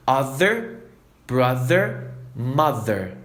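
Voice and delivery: brother, mother, other with a buzzing voiced th; voiced th demo. Shown as a demonstration. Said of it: "falar os três de uma vez".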